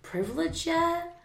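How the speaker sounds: teasing question. Retold or imitated. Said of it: "and I'm like".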